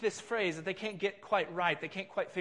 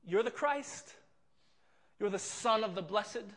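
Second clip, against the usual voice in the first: mocking laugh. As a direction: laugh at him as you ask it. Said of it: "emphatic kind of mocking phrase".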